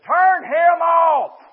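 mock holler, high, loud and drawn out; imitated hollering. Nursing home woman heckles the preacher, imitated holler. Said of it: "she was still a hollering".

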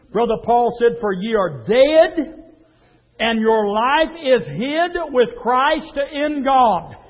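ordinary talk

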